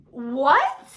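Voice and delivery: loud what, pitched sharply upward; shocked what. Retold or imitated. Girl introduced as girlfriend, shocked. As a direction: blurt it in disbelief.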